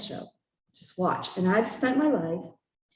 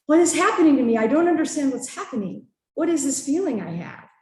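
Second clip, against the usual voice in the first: higher-pitched, urgent confused questions; overwhelmed questioning, raised voice easing off; retold bewilderment; 5 semitones higher, 4 dB louder than usual. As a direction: relive the confusion, starting loud and high. Relives bewilderment after breaking into tears.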